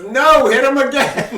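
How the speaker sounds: high, loud insistent demand; bossy little sister. Retold or imitated.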